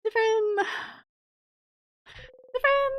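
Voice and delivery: very high squeaky yelp; squeak. Acted. Streamer reacting to the scene with a high squeak. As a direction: squeak the word out comically high.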